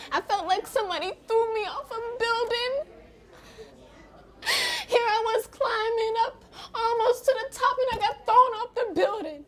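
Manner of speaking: anguished, high-pitched tearful cry. Acted. Recovering addict recalling her HIV diagnosis, crying. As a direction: break into sobs, voice rising high.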